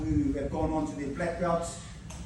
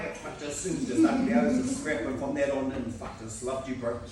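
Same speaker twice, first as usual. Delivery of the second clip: ordinary talk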